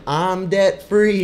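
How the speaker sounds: high, loud, drawn-out triumphant holler; imitated yell. Imitating caller to money radio show, triumphant yell. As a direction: holler it slowly like a celebrating caller. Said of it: "and you yelled".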